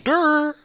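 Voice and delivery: high, loud dopey durr; mock a slow friend with a goofy durr. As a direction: make a dumb-guy noise at him. Mocking dumb noise.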